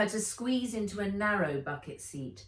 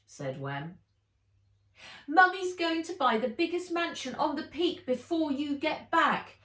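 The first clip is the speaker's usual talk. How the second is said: put-on much higher excited kid's voice; child voice; 10 semitones higher, 3 dB louder than usual. A narrator's reading. Wen and Henry telling Nat the news, in high children's voices. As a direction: voice the kids chattering.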